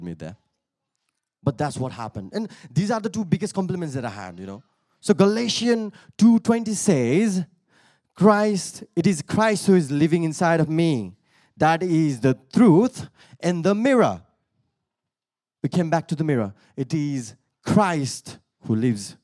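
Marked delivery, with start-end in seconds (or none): 5.0-14.2 emphatic scripture proclamation; preaching. Real, not acted. Preacher quoting Galatians to listeners, emphatic preaching. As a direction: declare the verse to the room.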